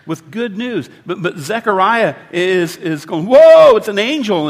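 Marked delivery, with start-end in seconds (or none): 3.3-4.5 sudden high, loud startled yelp; panicked gasp of alarm at an angel; freaking out. Retold or imitated. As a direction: jump out of your skin at the sight. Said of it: "and he's freaking out".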